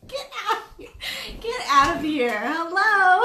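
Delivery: high, laughing through the words. Real, not acted. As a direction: crack up while talking. Wife laughing at husband's eyebrows.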